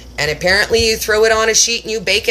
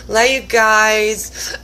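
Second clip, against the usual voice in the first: drawn-out sarcasm; 2 semitones higher, much slower than usual. Retold or imitated. Sarcastic repeat to family.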